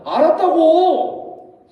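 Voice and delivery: loud, high, long-drawn whine of protest; acted bratty, exasperated holler; brazen back-talk. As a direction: yell back at mom, dragging it right out. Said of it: "겁대가리 없이 엄마한테".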